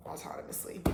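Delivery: whispered stress on autonomously; emphasis on autonomously. Real, not acted. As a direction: lean hard on autonomously in a whisper. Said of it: "Emphasis on the autonomously".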